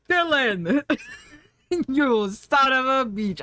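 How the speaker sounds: put-on slow Austrian accent, breaking into laughter; a gruff big-man greeting, laughing through it; a hint of Arnold, giggling. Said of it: "he's got a big man voice".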